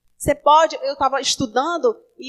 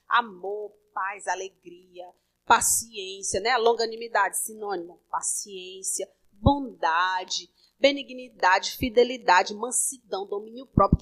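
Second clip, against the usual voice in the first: gentle recital; 6 semitones lower, slower than usual. Acted. Lists fruit of Spirit.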